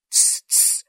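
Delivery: unvoiced hushing; whispered. A narrator's reading. Rabbit hushes Alice, whispering. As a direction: hiss a hush under your breath. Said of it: "прошептал кролик".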